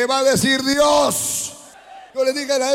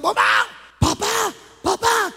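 put-on child calling out; 9 semitones higher than usual. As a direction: shout like an alarmed little daughter. Little girl calls her father.

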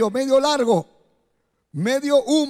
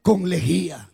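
altar call; 5 semitones lower than usual. Acted. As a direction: invite them gently, eyes closed. Altar call over music.

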